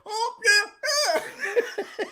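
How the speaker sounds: high, blurred vowels; mock high hooting reply; imitated deaf girlfriend. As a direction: mimic a deaf woman's cry. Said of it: "was deaf too".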